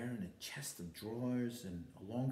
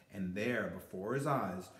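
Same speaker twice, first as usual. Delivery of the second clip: plain reading voice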